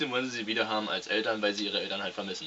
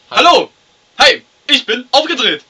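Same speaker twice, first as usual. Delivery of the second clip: hyper and shouting, much higher; too much caffeine; 22 dB louder than usual. Acted. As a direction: bounce off the walls, yelling. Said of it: "Mein Co-Moderator ist heute wieder aufgedreht".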